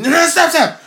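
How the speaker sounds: high, loud shout; yelling. Real, not acted. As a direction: yell at him to stop. Kid yelling at dad in game.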